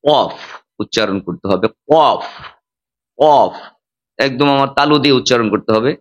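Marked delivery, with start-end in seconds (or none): 0.0-3.5 কফ with the tongue pressed to the palate; deep qaf demo. Shown as a demonstration. Imam teaching worshippers the deep কফ sound. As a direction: hit the qaf in কফ hard at the back. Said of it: "তালুর সাথে জিহ্বাটাকে লাগাইয়া".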